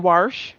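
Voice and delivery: WASH pronounced "warsh"; intrusive R. Shown as a demonstration. Challenging people who add an R, WASH as "warsh". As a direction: stick an R into WASH. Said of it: "where there's a R in wash".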